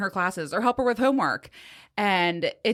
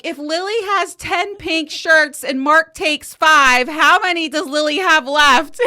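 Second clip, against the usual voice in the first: daughter calling out question; 9 semitones higher, 10 dB louder, slower than usual. Retold or imitated. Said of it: "then she's like".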